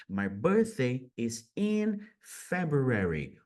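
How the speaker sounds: slow "My birthday is in February" with weight on "in"; slow model sentence. Shown as a demonstration. Teacher slowly models a birthday sentence. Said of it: "Olha a pronúncia".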